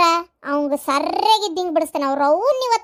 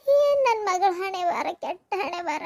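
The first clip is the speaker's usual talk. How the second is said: crying lament; slower than usual. Acted. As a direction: mourn the daughter's fate through tears. Mother wails over fate.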